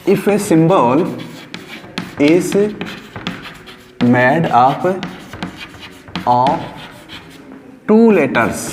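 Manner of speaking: very slow, spaced rule read for notebooks; slow dictation. Shown as a demonstration. Teacher dictating the second rule to students, very slowly. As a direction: dictate word by word with pauses. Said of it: "वो आप लोगों को अपनी notebook के अंदर लिखना है".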